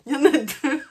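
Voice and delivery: laughing through the words; giggling while speaking. Real, not acted. Second read, laughing.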